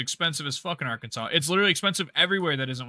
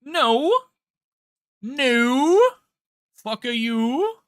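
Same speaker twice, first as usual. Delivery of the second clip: much higher, louder outraged no; incredulous shout; 11 semitones higher, 8 dB louder, much slower than usual. Retold or imitated. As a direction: shout it in disbelief. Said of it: "and it's like".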